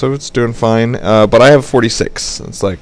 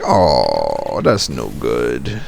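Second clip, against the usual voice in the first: mock low disappointment; 9 semitones lower, 4 dB quieter, much slower than usual. Corey disappointed in retold chat.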